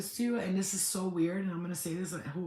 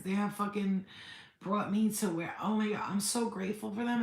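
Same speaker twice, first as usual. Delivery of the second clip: ordinary delivery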